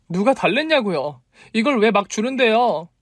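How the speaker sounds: high, indignant complaint; angry outburst. A narrator's reading. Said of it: "저도 모르게 버럭 화를 냈어요". (버럭 화내며 따지는 말투)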